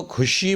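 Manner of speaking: 'खुशी' with aspirated k, not throaty kh; common mispronunciation. Shown as a demonstration. Teacher quotes what people say, mispronounced. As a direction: start 'खुशी' on a puffed k. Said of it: "ये गलत".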